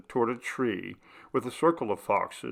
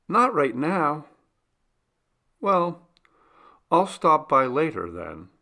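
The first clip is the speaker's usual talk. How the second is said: higher, lighter voice for Charlie; 6 semitones higher, 4 dB louder than usual. A narrator's reading. Charlie speaking in story.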